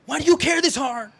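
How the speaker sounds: high, loud indignant yelling; retold yell. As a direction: shout it at the customer service rep. Retelling yelling at the phone company.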